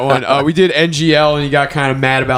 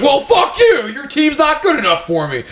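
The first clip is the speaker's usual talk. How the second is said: high furious mock shout; Seymour-style outburst; 12 semitones higher than usual. Retelling snapping at the coach, high mock furious shout. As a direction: explode in a petty tantrum. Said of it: "I had a Seymour reaction".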